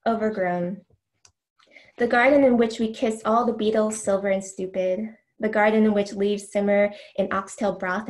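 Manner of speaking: measured, deliberate poetry recital; reading verse to an audience; poem reading. Acted. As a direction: let each image land as you read. Reads a poem aloud.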